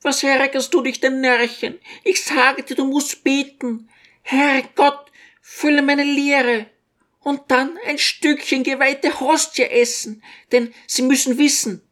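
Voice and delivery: put-on a fretting little old woman doting on her son; old mother's voice. A narrator's reading. Old mother fussing over her son to the guests, high-pitched.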